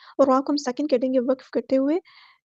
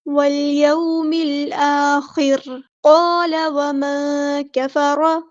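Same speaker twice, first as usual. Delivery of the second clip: slow, drawn-out chanted recitation; tajweed chant; Quranic recitation; 3 dB louder, much slower than usual. Shown as a demonstration. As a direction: recite it melodically, stretching each sound. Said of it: "recites Quran verse in tajweed".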